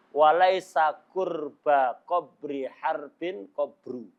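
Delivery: slow, syllable-by-syllable verse; careful slow recitation. Shown as a demonstration. Teacher presenting a famous hard-to-say Arabic verse, slowly. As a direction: spell out the hard line slowly, piece by piece. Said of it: "sulit diucapkan itu".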